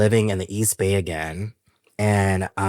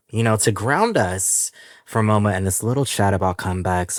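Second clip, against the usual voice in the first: ordinary talk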